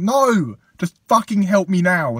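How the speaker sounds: retold angry plea for help; exasperated. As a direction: lean on every word, loud and fed up. Retold quarrel with nan.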